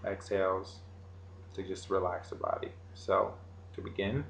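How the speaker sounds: soft, slow meditation guide voice; right in your ear. Acted. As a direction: guide them gently into relaxing.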